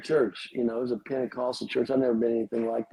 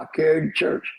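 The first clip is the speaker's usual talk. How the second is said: lightly imitated Alabama speech, a touch higher; 5 semitones higher, 3 dB louder, faster than usual. Mimicking the teacher's Alabama phrase in a mild Southern accent. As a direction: echo an Alabama teacher's "carry you to church" with mild Southern lilt. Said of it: "You know, they talk in Alabama".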